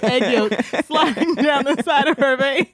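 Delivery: normal talking voice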